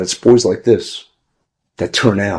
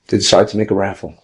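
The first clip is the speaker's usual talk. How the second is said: usual speaking voice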